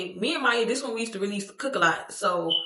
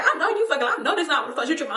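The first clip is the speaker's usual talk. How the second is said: higher, fast, laughing disbelief; cousin laughing; 7 semitones higher, faster than usual. Retold or imitated. Cousin on FaceTime, laughing in fast disbelief. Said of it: "She said".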